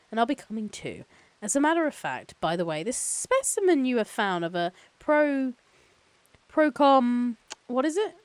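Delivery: high, loud phone-call pleasantries; chipper company lawyer. Acted. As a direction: play an eager corporate counsel introducing himself. Voices Gennaro on the phone.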